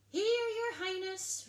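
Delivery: lightly voiced royal guard; guard's reply. A narrator's reading. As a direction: answer the princess like a dutiful guard. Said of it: "replied the guard".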